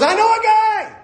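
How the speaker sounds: high, loud, eager volunteering; eager outburst. Acted. Ziba volunteering someone to the king, eager shout. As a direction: pipe up loudly, high-pitched and keen.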